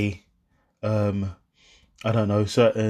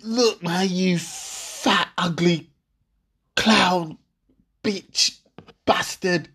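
higher, spat-out insults; mock insults; 7 semitones higher, much slower than usual. Boyfriend hurling insults at his girlfriend, higher. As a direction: fling each insult separately. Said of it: "I might even throw in there".

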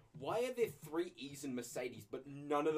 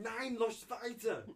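put-on German accent; 4 semitones higher, much slower than usual. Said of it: "You did not do German accent?".

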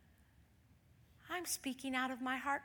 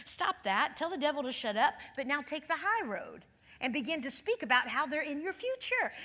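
ordinary talk

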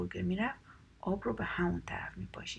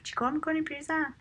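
high, louder storybook outcry; choking protest; 8 semitones higher, 6 dB louder than usual. A narrator's reading. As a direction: exclaim it high as the bothered wolf. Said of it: "گرگه به سرفه افتاد". (اعتراض بلند و زیر گرگ)

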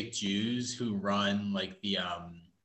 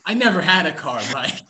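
louder, higher protest against the teasing; indignant protest; 5 semitones higher, 10 dB louder than usual. Real, not acted. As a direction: push back loudly, half laughing.

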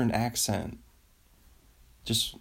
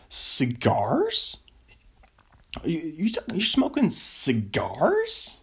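lightly imitated incredulous question, rising at the end; mock-baffled impression, stretched out; Brendan Schaub; 6 semitones higher, much slower than usual. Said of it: "say it like Brendan Schaub".

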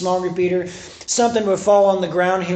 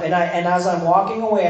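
usual speaking voice

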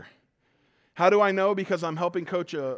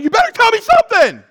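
high, loud demand, hammed up; mock threatening yell; 13 dB louder than usual. Coach demanding answers from umpire, high loud mock yell. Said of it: "I'm like".

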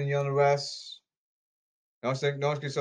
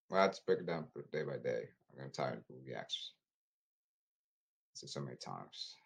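muttering under his breath, far softer, fast; quiet muttering; 12 semitones lower, 10 dB quieter than usual. Real, not acted. Fed-up streamer grumbling to himself about movie acts, quiet mutter.

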